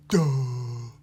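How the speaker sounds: dopey, drawn-out "duh"; slow, dull character. Shown as a demonstration. Teacher showing a puppet's core sound, slow dull voice. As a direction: open the back of the throat, dim-witted. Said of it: "a character that's a little bit slow or dull".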